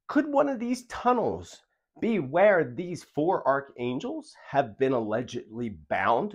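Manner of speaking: ordinary delivery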